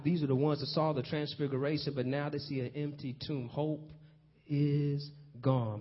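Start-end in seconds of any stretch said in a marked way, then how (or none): none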